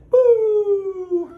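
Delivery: high loud mock siren whoop; sudden siren sound effect; siren noise. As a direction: imitate a police siren. Sudden police siren moment.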